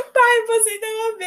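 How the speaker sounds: high, tearful announcement; imitating herself sobbing the news; crying on the phone. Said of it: "eu chorando no telefone".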